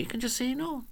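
gently, with the volume well down; gentle refusal. Shown as a demonstration. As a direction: show how to refuse without any force. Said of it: "You say it gently".

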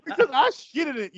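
high-pitched imitated comeback; mock quarrel retort. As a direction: act out the spouse snapping back, half laughing. Acted reply in sheriff's quarrel scene.

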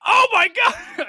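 very high, loud, drawn-out mock scream. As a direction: stretch out a shriek of horror. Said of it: "I scream again".

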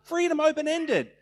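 "Wanna do this?" sung high and open; high open-ended lilt. Shown as a demonstration. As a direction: float it up, light and open. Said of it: "it says".

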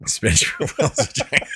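amused, breaking into giggles; laughing repeat. Real, not acted. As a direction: repeat the joke through real laughter. Repeats friend's joke, laughing.

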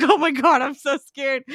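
high, breathless; mock fear. Voicing the audience's fright, high mock fear. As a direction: gasp it as a scared onlooker. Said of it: "you're like".

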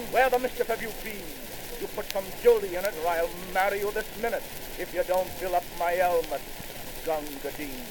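usual speaking voice

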